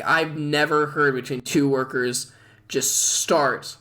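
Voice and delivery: ordinary delivery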